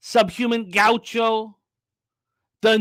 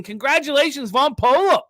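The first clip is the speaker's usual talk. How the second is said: excited congratulations; 8 semitones higher, 5 dB louder than usual. Real, not acted. Host congratulating Von Polo on his baby, excited. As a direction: pitch jumps up, bright and louder.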